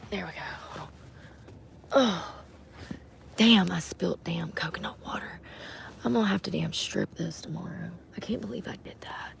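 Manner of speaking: hushed whisper to herself; muttered whispered cursing. Real, not acted. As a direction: whisper so as not to wake anyone. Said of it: "alone at night in car".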